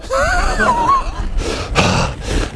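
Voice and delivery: high, bursting laugh at his own joke; big laugh. Real, not acted.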